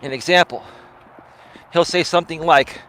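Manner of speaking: ordinary talk, nothing marked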